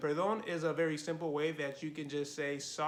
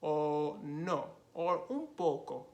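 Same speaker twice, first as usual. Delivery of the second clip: very slow, drawn-out "sí o no"; slow demo; slower than usual. Shown as a demonstration. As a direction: stretch "sí o no" out slowly. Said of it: "yes or no".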